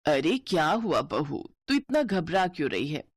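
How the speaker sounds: plain reading voice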